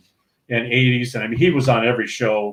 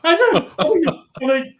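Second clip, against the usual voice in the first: laughing disbelief; 12 semitones higher than usual. Retold or imitated.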